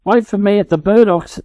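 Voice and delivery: high call after the running ducklings. A narrator's reading. Mother duck calling to her running ducklings. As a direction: call after them as they run. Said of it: "their mother called".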